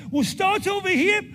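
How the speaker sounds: loud, high rallying calls to a big audience; excited crowd warm-up; calling out to the crowd. Real, not acted. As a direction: shout across the hall to get everyone's hands up.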